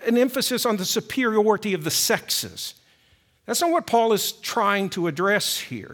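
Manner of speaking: ordinary delivery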